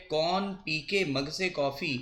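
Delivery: spelled out slowly, word by word; slow mnemonic recital. Shown as a demonstration. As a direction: recite the memory trick slowly for students. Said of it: "example याद करने का technique है".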